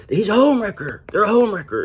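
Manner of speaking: high mocking imitation of an accuser. Imitating the accuser calling her a home wrecker, high and mocking. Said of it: "is what he said".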